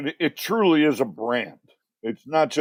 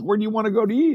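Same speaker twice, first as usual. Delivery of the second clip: higher mock wife voice; husband imitates his wife's question in a higher voice; mimicking his wife; 9 semitones higher, much faster than usual. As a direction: play the wife, pitched up and light. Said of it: "she says".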